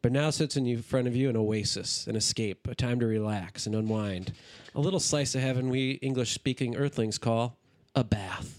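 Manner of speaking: commercial voiceover. Acted. Hams up a bath commercial.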